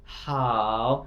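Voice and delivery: normal talking voice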